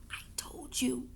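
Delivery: silent, breathy mouthing; mouthed whisper. A narrator's reading. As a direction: barely voice it, just mouth the words. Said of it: "she mouthed".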